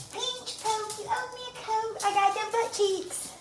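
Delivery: high, soft squeaky pet voice; dog's inner voice. Pretend. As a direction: speak for the dog, high and quiet. Voicing the dog's thoughts.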